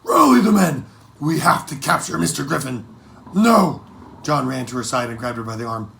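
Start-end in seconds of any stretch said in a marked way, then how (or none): 0.0-2.9 higher, louder furious command to the crew; fiery order. Acted. Captain mother orders the crew in fiery rage. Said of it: "Then came the fire and rage". 3.3-3.8 higher, louder burst of protest; urgent no. Acted. Boy stops his mother with a loud urgent no.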